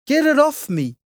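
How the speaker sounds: very high, loud panicked cry; panicked shout. Acted. Mum begging Dad to pull the octopus off, panicked shout. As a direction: Mum pleading to be freed, panicking. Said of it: "shouted Mum".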